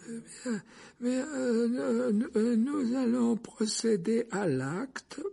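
put-on very high, slow, hesitant old official; quavering voice. A narrator's reading. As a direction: quaver haltingly, pitched far up. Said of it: "la voix chevrotante du membre de la commission". (voix chevrotante, très aiguë et hésitante)

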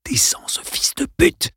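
urgent unvoiced whisper; fierce whisper. Arlène urges Dale, fierce whisper. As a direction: spit the curse under breath.

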